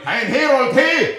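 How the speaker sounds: high, loud call for a herald. Acted. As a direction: shout for the herald across camp. Calling for a herald.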